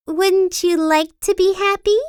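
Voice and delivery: put-on high, cheery girl voice; a curious, sunny lilt up high; bright Little Miss Sunshine. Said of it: "she asked".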